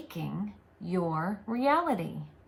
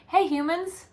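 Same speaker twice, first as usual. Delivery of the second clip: cheerful greeting; 9 semitones higher, 5 dB louder than usual. Acted. Entity greets humans cheerfully.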